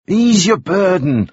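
high, slow, drawn-out urging; coaxing. A narrator's reading. Balfour urges Moody to unburden himself, coaxing and slow. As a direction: coax it out of him slowly.

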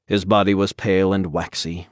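usual speaking voice

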